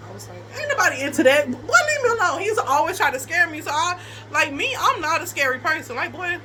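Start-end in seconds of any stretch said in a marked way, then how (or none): 0.6-2.4 higher-pitched, mildly annoyed retort; exasperated brush-off. Retold or imitated. Woman retelling her reply to his scare tactics, exasperated. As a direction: brush him off, voice rising.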